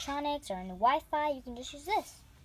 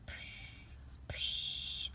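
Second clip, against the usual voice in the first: whispered punch sound effects; 7 dB quieter than usual. Pretend.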